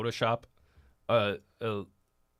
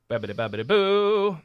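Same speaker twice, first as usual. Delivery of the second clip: sing-song nonsense; 6 semitones higher, 4 dB louder than usual. Acted.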